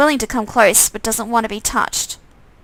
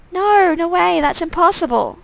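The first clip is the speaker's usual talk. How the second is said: higher disbelieving protest; denial; 7 semitones higher than usual. Pretend. Player denying the twin's claim, higher. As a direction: refuse to believe it.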